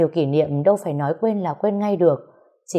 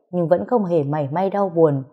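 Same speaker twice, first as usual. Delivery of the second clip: ordinary delivery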